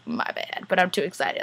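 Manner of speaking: hushed, excited confession; apologetic aside. Real, not acted. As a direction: drop to a near whisper. Apologetic aside about talking fast.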